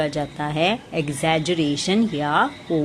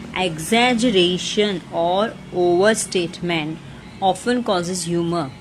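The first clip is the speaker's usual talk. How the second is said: slow reading aloud; much slower than usual. Shown as a demonstration. Teacher reading the question slowly to students. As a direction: read slowly, word by word. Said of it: "third question पढ़ रही हूँ मैं".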